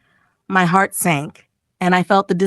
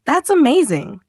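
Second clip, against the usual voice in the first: a mild excited lift, higher pitch; read-aloud delight, pitched up; understated happy exclamation, pitched higher; exclaimed; 7 semitones higher than usual. A narrator's reading. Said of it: "I exclaimed".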